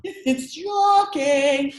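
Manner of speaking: high, loud, long-stretched English accent; hammed-up English, lingering on the vowels. Shown as a demonstration. Guest following the host's cue, exaggerated English accent. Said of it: "You gotta say it like".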